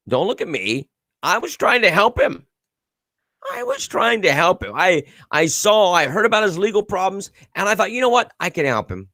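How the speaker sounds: high-pitched mock innocence; mock defensive protest. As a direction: plead innocence in a hammed-up high voice. Acting the accused man's defensive excuse.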